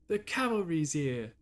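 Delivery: drawn-out amateur British accent; slow British catchphrase call, Tracer style; Tracer British impression. Shown as a demonstration. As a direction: try a playful British hero voice. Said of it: "a Tracer impression here and there".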